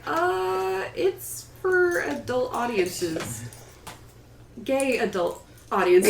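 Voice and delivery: slow, hesitant drawn-out confession; awkward slow reveal. Retold or imitated. Author telling school staff what she writes, slow and awkward. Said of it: "I was like".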